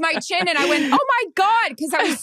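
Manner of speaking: gushing about hitting her chin on his shoulder; excited retelling. Real, not acted. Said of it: "'cause I was so excited".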